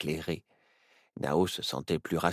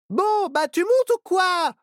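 much higher, louder call from upstairs; calling down the stairs; 9 dB louder than usual. A narrator's reading. Myrtille calls down to Nao from the top of the stairs: high, louder, impatient. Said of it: "du haut de l'escalier".

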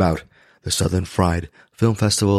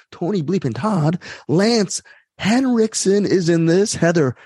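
excited; 10 semitones higher, slower than usual. Real, not acted. Excitedly listing horror stars.

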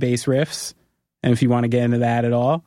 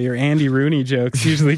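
normal talking voice

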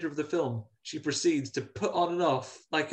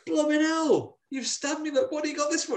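much higher, strained demon voice; stabbed demon's outrage. Retold or imitated. Demon just stabbed by Carly, crying out in high outrage. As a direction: yelp it out like a wounded demon. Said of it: "And he goes".

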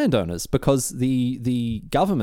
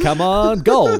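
louder, higher plea for gold; mock pleading shout; 8 semitones higher, 7 dB louder, much slower than usual. Settlers begging the land for gold, hammed-up loud plea. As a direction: beg loudly like a desperate prospector. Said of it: "settlers begging for gold".

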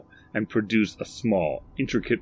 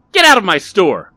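loud, high angry bark; 12 semitones higher, 16 dB louder than usual. A narrator's reading. Barry throws Rodney out of his shop, barking. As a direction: bark it as an order. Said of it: "barked Barry".

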